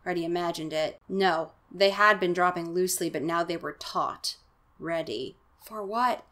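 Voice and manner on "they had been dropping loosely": ordinary delivery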